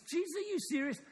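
high-pitched mock disbelief; incredulous attitude. Doubter answering Jesus about the last days, incredulous. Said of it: "difference in those two a-, attitudes".

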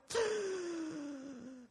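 imitated gasp. Husband about to die suddenly draws breath, imitated gasp. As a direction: imitate one drawn-out desperate intake of breath. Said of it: "he goes".

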